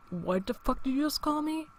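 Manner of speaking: a quiet threat, imitating how it turned; voice gone low. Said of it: "And my voice got all low".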